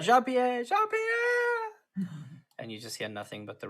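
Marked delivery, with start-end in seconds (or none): 0.0-2.1 very high, drawn-out call; acted yell. Game master voicing a player yelling for Jean-Pierre, high acted yell. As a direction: call out as if across rushing water. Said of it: "so you yell out".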